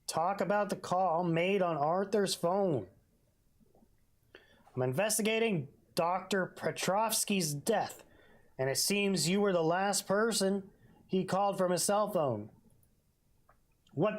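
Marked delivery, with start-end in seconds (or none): none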